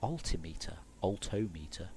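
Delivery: low, soft try at "Altimeter", then "Altometer"; muttered pronunciation test. Shown as a demonstration. Rehearsing the word, softly comparing "Altimeter" with "Altometer". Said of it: "pronunciation and cadence and stresses".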